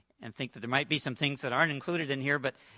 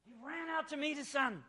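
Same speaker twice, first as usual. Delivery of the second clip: excited exclaim; 11 semitones higher, 7 dB quieter than usual. Real, not acted. Preacher's climax, father runs.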